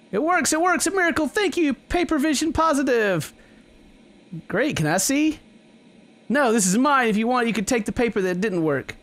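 high-pitched elated character voice, then busy and dismissive; high, animated miracle-struck character; overjoyed game character. Acted.

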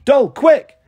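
high, loud, urgent call; shouting. A narrator's reading. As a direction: call out to hurry someone up. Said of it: "Marigold shouted".

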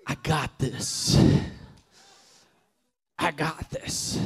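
grit it out like nothing will stop you; determined self-talk. Retold or imitated. Injured player vowing aloud to get up and win, fierce.

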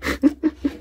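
short laugh at himself; high-pitched laugh. Real, not acted. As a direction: laugh at the bad impression. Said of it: "I don't know how good that was".

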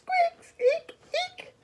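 tiny, very high squeaks; very high-pitched mouse voice. Acted. Field mouse character squeaks.

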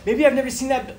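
high, loud sudden wonder; half-shouted maybe I've never seen that; excited wondering. Retold or imitated. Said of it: "and you're like".

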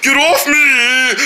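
slowed, grumbly mock seal; slow grumbling seal. Kid voices the seal grumbling at riders, slow. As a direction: grumble it out slowly. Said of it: "he gonna start rolling like".

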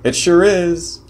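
high, loud, drawn-out burst of excitement; thrilled interjection, voice leaping way up; excited exclamation. Retold or imitated. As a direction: jump in with slow, high-pitched enthusiasm. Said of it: "interrupted and excitedly said".

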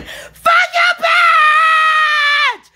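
high, loud cursing scream; raw screaming outburst. Real, not acted. Lashing out at unseen tormentors, shrieking. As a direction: shriek the curse out at full force. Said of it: "This yelling and screaming".

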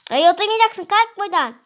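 high, loud snap to get up and leave; angry shout. Acted. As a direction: shoo her off the seat, loudly. Angry sister chasing her off.

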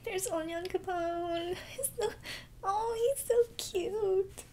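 high tearful voice, slow; crying and gushing. Real, not acted. Crying viewer gushing over a cute character, high and tearful. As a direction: squeak it out through tears.

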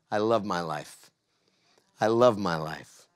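low, unhurried and heartfelt; calm, grounded affirmation in a low voice; sincere affirmation. Shown as a demonstration. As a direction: say it low, like a private vow. Models the four-word morning affirmation.

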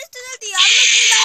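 loud frustrated outburst; frustrated yell. Real, not acted. Frustrated gamer yelling. (grito de frustración)

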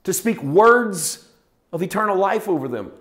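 loud, high full-force sermon; preaching. Acted. Pastor preaching hard to his congregation, full force. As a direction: preach it out to the whole room.

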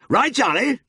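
high, loud yell to a farmhand; excited high-pitched shout; yelled order. A narrator's reading. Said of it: "he yelled".

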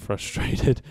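laughing it off in frustration; exasperated little laugh; frustrated laugh. Real, not acted. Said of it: "I'm getting a bit".

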